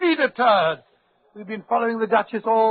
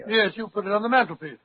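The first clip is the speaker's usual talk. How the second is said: ordinary talk, nothing marked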